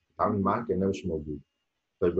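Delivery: ordinary talk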